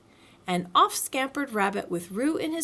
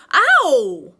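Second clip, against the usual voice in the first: loud pained Ow on landing; loud high yelp of pain; Piglet's ow; 6 semitones higher, 13 dB louder than usual. A narrator's reading. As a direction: yelp as he comes down.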